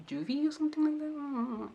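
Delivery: high, soft, trailing-off aside; unsure aside. Real, not acted. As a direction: toss it off quietly in a high little voice. Unsure aside about the term.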